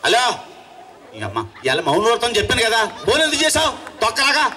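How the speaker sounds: furious shout. Acted. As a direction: explode at being disturbed. Caller bursts out shouting.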